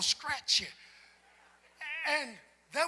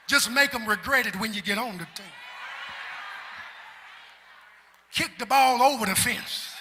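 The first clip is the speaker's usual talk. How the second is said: loud, rising sermon delivery with a singsong lift; full-force preaching; 16 dB louder than usual. Acted. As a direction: preach it out to the congregation.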